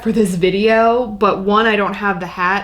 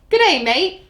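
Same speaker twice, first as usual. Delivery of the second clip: higher, botched British accent that sounds Australian; attempted British accent; 6 semitones higher than usual. Shown as a demonstration. Co-host shows off a promised British accent on request, badly done British accent. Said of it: "I can do a British accent".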